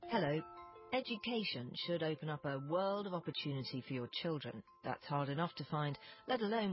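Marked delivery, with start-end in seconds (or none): none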